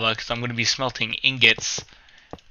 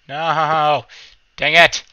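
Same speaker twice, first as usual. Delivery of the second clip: loud, raised-pitch yell; frustrated outcry; 5 semitones higher, 11 dB louder, slower than usual. Real, not acted. Gamer to self over a mishap, loud frustrated cry. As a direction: cry out in annoyance.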